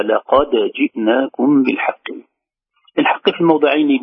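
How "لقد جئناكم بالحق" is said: لقد جئناكم بالحق, slow, dal of لقد bounced; slow measured recitation of لقد جئناكم بالحق; Quranic recitation with qalqala. Shown as a demonstration. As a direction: recite slowly, sounding the dal of لقد clearly. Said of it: "أما هنا".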